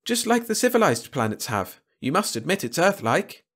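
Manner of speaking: high-pitched, boastful alien voice; proud Malan. A narrator's reading. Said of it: "the man stated proudly".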